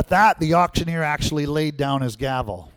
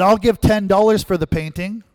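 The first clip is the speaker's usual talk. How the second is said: shouted bid; 6 semitones higher, 6 dB louder than usual. Retold or imitated. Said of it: "shouted out".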